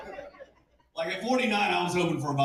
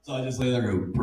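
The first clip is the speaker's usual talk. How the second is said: usual speaking voice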